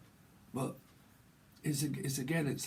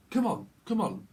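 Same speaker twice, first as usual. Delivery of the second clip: raised, insistent northern English call; lightly put-on northern English voice; northern accent; 6 semitones higher, 4 dB louder than usual. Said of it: "like, a northern accent".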